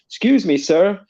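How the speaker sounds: high mock plummy university English accent; plummy posh English accent. Woman at charity stall, mimicked in plummy English accent. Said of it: "with her very plummy university accent".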